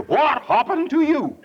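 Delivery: high, slow incredulous question; mock astonishment. Preacher asks the droopy woman, high mock astonishment. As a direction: draw it out in playful shock.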